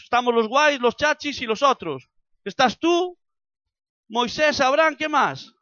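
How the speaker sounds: loud, impassioned sermon delivery, lifted high; preaching at full force. Acted. Preacher urging the church to grow, loud and fervent. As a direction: drive the message home to the congregation.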